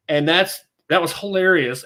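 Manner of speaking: usual speaking voice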